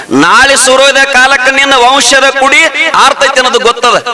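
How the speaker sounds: high, ringing sermon voice; fervent village preacher; preaching at full force. Acted. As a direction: preach it out to the crowd. Son's emotional speech, preached.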